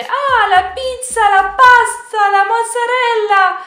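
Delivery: put-on over-the-top Italian accent, high and slow; mock Italian clichés. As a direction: sing out the clichés like a caricature Italian.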